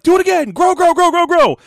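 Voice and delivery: loud, very high mock shout; shrieked chant of orders, played for laughs; frantic command. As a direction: act out relentlessly urging it on.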